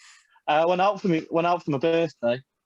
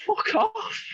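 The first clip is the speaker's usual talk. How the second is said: mock squeaky retort. Telling the Arsenal fans to go, much higher squeaky retort. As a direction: squeak it out as a cheeky parting shot. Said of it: "and I went".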